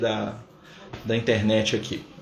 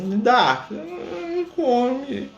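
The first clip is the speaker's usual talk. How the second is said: put-on high, very slow pleading child; child begging; 11 semitones higher, much slower than usual. Child asking the parent for the last sweet, high pleading. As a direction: stretch it out like a little kid begging. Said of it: "seu filho assim".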